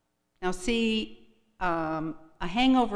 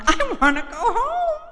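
much higher whimper with a laugh; whiny plea; 6 dB louder than usual. Retold or imitated. Her reply to Martha in the car, high scared whimper. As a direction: squeak it out like a scared kid.

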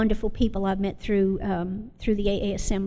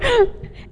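nervous laugh; 13 semitones higher, 3 dB louder than usual. Real, not acted. Nervous laugh after "oh crap.

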